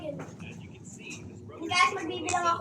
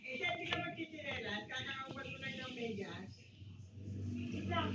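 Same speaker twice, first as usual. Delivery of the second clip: whispering while hiding; 5 semitones lower, 17 dB quieter than usual. Real, not acted. Hiding, speaking to a sibling.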